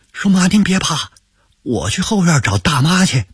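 put-on boy consoles his mother; crying. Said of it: "哭着说".

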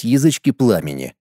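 ordinary talk, nothing marked

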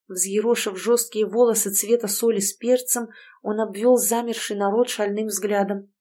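ordinary delivery, nothing marked

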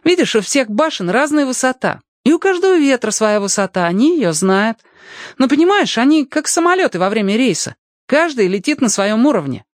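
put-on high-pitched boy's voice; lively boy. Boy explains the winds to his new friend, high boyish voice. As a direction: explain it eagerly, pitched well up.

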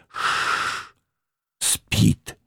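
hissed, voiceless hush; hissing whisper. A narrator's reading. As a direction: hiss it to keep quiet. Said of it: "она зашипела на него". (шипящий шёпот)

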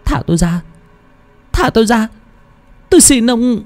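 high-pitched, desperate begging; wretched crying plea. A narrator's reading. Girl begging an old man to release her, wretched plea. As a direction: plead in a high voice, as a girl in distress. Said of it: "Tiếng cô gái kêu khóc thảm thiết".

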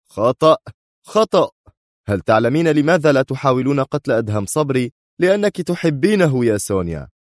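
high, heated accusation; sharp shouting. A narrator's reading. Man contradicts Sonia's excuse, shouting sharply. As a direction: burst out high on each denial. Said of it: "صاح في حدة".

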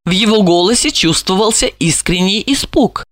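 plain reading voice

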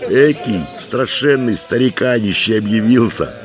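high-pitched, jovial soldier's call; merry shouting. A narrator's reading. Soldiers point out the old man to their commander, merry shout. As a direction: call out in high mock alarm, cheerfully. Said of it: "весело кричали ратники".

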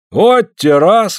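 high, drawn-out astonishment; surprised. A narrator's reading. Afanasy hears they leave tomorrow, surprised exclamation. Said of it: "удивляется Афанасий".

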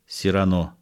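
normal talking voice

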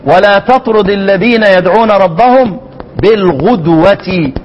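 slow down on "بالغدوة", u vowel on the غ; verse recitation with "بالغدوة" said ghudwati; damm on ghayn. Shown as a demonstration. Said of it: "وبالغدوة الشامي بالضم".

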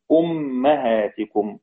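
أمهاتكم drawn out as "ummahātikum", u on hamza, a on mim; Hafs's reading. Shown as a demonstration. Teacher showing how Hafs reads, أمهاتكم as slow "ummahātikum". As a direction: say أمهاتكم slowly, "um-ma-hā-ti-kum". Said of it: "يقرأ حفص بضم الهمزة وفتح الميم".

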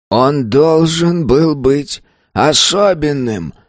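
put-on high, slow elderly voice; a frail old man pressing his point. A narrator's reading. Old man speaks to mighty man. (голос старика)

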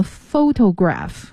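photograph said slowly, stressed PHO-to-graph; stress on first syllable. Shown as a demonstration. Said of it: "也是重音在第一个音节fo". (photograph 慢读，重音在第一个音节)

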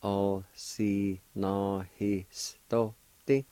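"Osinohistote" stretched out very slowly. Shown as a demonstration. Teacher slowly modelling the word for learners. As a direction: draw out "Osinohistote" carefully. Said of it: "like this".

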